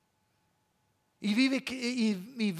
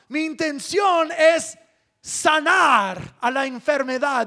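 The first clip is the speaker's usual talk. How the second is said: loud, raised, forceful sermon delivery; preaching at full force; 6 semitones higher, 9 dB louder than usual. Real, not acted. Preacher voicing God's intention to heal the people, full-force preaching. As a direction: proclaim it to the whole congregation, driving each phrase.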